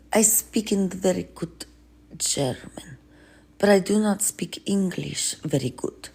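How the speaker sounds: a hint of a heavy Russian accent, ponderous; Putin with a Russian accent. Healer greets Russian leader, mild Russian accent. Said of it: "Hey, Putin".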